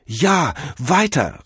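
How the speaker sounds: high, loud urging; eager cry. A narrator's reading. Cordell urges the god on, eager cry. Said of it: "rief Cordell".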